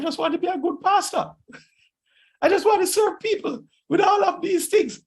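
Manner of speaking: squeaky mock-pleading protest; high-pitched plea. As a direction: plead in a comically high voice, with a laugh. Retelling his own plaintive reply, laughing.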